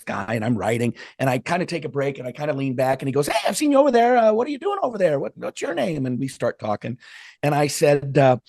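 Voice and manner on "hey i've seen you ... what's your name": eager stranger. Retold or imitated. Voicing eager stranger at Starbucks.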